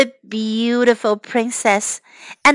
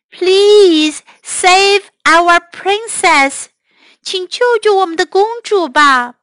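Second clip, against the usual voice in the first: pleading royals; 6 semitones higher, 4 dB louder than usual. Acted. King and queen plead.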